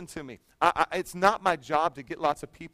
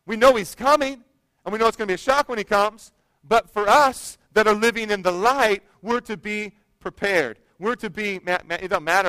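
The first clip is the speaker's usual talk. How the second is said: louder, rising preacher's call to the congregation; full-force preaching; 5 semitones higher, 8 dB louder than usual. Acted. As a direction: preach it out to the church.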